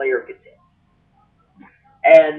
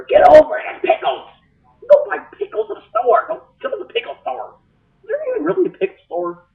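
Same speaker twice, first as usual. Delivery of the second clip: mock rant; 12 semitones higher than usual. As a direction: scold them in a hammed-up rant. Ranting at pickle complainers.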